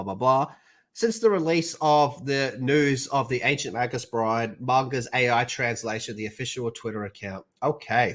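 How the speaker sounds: ordinary talk, nothing marked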